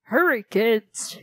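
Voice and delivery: theater owner hurrying the kids, lightly voiced call; hurried shout. A narrator's reading. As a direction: urge the kids along in a hurry. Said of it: "he shouted".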